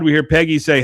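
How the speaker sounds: ordinary talk, nothing marked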